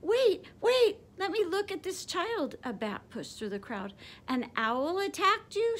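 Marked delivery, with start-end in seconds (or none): 0.0-2.6 much higher, louder eager mother bat; excited bat mother calling wait; urgent mother bat. Acted. Mother bat pushes through crowd. 4.3-5.8 much higher, startled mother bat; mother bat, startled by the news; alarmed question. A narrator's reading. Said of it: "she asked".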